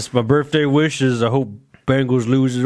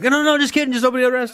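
high, laughing panicked take-back; hammed-up panic, voice jumping high through laughter; panicked backtrack; 11 semitones higher, much faster than usual. Friend opening the gift, in high laughing panic. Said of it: "you're like".

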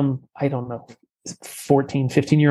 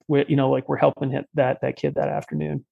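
ordinary talk, nothing marked

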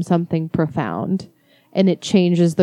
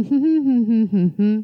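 short repeated hums, pitched up; humming; 2 semitones higher than usual. Shown as a demonstration. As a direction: hum a few quick notes. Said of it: "They go like".

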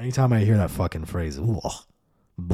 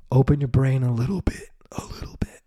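a crying whisper that breaks into breath; tearful whisper; 5 semitones higher than usual. Acted. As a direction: beg them through tears, barely voiced. Pleading with listeners, mock tearful.

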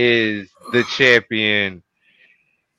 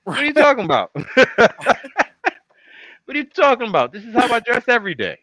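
mock offended; 10 semitones higher, much faster than usual. Mock offended high protest.

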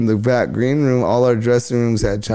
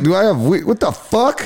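incredulous; 7 semitones higher than usual. Retold or imitated. Cheech asks him for weed.

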